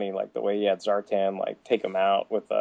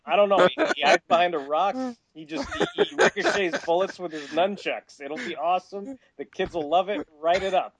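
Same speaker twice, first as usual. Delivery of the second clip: higher, lightly mocking exec voice; improvising executive; 9 semitones higher than usual. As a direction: make up a silly pitch on the spot. Exec improvises an absurd pitch.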